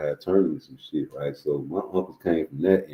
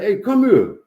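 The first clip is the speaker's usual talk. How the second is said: raised, high summons; imitated calling out; 14 semitones higher, 6 dB louder than usual. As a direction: call the kid over like a dad. Retelling dad calling him over.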